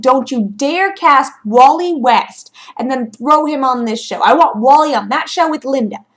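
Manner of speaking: loud, emphatic scolding; indignant warning. Retold or imitated. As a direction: warn them off, hitting the words hard. Acted indignant warning to the Flash.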